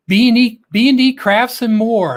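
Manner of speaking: excited host welcome; announcer call-out. Acted. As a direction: boom the channel name to welcome them. Host calls out viewer's channel name.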